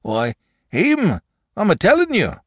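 high, loud rustic insistence; agitated shepherd. Acted. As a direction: insist as a rattled countryman. Agitated shepherd answers his wife.

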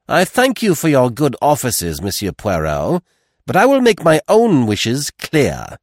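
high, forceful woman's voice; imperious mother. A narrator's reading. Mrs Renauld overruling Poirot from the stairs, imperious. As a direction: cut in with icy formality, pressing harder to the end.

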